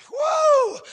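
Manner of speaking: very high, loud held "woo"; piercing pulpit whoop; exultant whoop. Real, not acted. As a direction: break into a revival holler. Preacher whoops at sermon climax.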